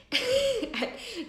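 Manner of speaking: short high-pitched laugh. Real, not acted. Streamer laughing at her own caffeine obsession, high laugh.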